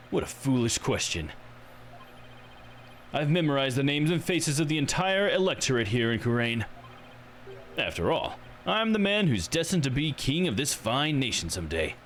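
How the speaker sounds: lightly acted self-important politician; pompous politician. Politician boasting he is destined for king, lightly pompous. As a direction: declaim it with a touch of grandeur.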